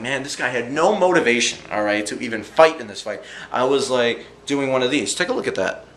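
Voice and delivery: usual speaking voice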